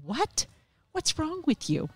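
high, incredulous retort. Retold or imitated. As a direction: pitch leaps up in mock disbelief. Husband's shocked reply, acted.

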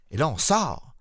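ordinary delivery

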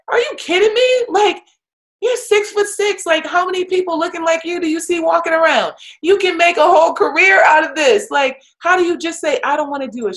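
high, loud, fast disbelief; incredulous scolding. Retold or imitated. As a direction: pitch it way up like you can't believe her. Coach incredulous to player.

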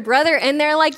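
ordinary delivery

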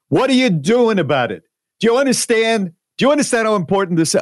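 high, pushy Brooklyn woman; thick Brooklyn accent. Retold or imitated. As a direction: confront a stranger in thick Brooklyn. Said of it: "she's got this thick Brooklyn accent".

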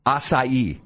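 acai said ah-sigh-EE; careful correct pronunciation. Shown as a demonstration. Host showing co-host the right way, acai as ah-sigh-ee. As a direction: say acai in three parts, ah-sigh-ee. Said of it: "try to pronounce them correctly".

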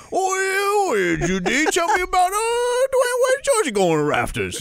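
overexcited wacky sports host; hype voice. Retold or imitated. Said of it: "You want me to be Jay Ajayi".

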